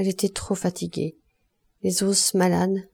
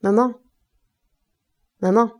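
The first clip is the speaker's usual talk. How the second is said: calling from the next room, raised and rising; 3 semitones higher, 5 dB louder than usual. A narrator's reading. Son calls his mother from his room, raised call. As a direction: call for your mother from behind the door. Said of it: "la voix de Goujet qui l'appelait".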